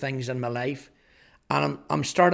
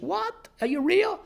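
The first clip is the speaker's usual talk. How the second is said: mock-high, astonished gasp of a mother; as if the news can't be true, pitched way up; stunned disbelief. Mum stunned by son's news.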